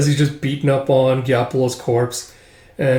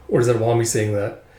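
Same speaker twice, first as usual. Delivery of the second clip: plain reading voice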